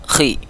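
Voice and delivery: "Khi" with a whispery scrape in the throat; throaty kho. Shown as a demonstration. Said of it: "ini agak bunyi di tenggorokan".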